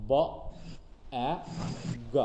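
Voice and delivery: ব, এ, গ spelt out one sound at a time with pauses; sounding out each letter for students. Shown as a demonstration. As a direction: spell ব, এ, গ slowly, letter by letter. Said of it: "মিলে হচ্ছে কি? Bag".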